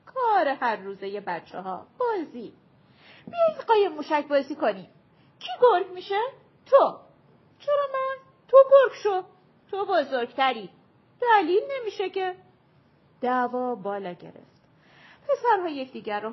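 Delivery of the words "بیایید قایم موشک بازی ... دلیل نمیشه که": put-on high, loud squabbling kid voices; shrill back-and-forth of quarrelling children; children bickering. A narrator's reading. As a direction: play the kids arguing over who has to be it. Children quarrel over who is wolf.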